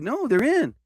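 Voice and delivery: high-pitched retold correction; imitated insistence. Cook correcting him about the team, imitated insistence.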